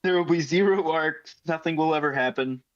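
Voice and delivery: ordinary talk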